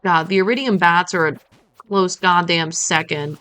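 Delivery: usual speaking voice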